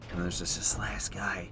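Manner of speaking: hushed tense whisper; real whispered aside. Real, not acted. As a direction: breathe it out so the boss won't hear. Quiet aside in tense fight.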